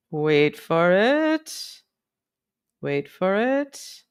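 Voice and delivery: slow, stretched words; slow singsong stalling; drawn-out suspense. Pretend. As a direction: stretch it out, teasing the wait. Drawing out a suspenseful wait.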